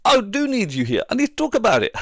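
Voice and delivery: hammed-up wife demanding he come back; wife's voice. Wife calls husband back to talk, high loud hammed-up demand. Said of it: "She's like".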